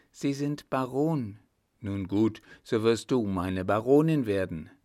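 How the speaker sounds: ordinary delivery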